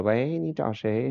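put-on soft, slow little kid voice; childlike voice. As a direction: imitate a small child answering the phone. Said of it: "奶声奶气的".

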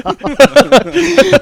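loud high laugh trailing down; can't stop laughing. Real, not acted. Laughing at coach's order. (忍不住高声大笑)

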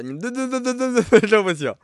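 high, loud excited laughing. Real, not acted. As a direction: burst out high and loud with glee. Laughing excitedly at pig question.

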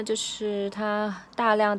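ordinary talk

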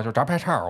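炸排叉儿 with a rolled 儿 ending; 排叉儿 with erhua. Shown as a demonstration. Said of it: "你这么清音吗？".

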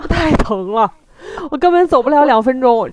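laughing loudly through the complaint. Real, not acted. Laughing complaint about the pain.

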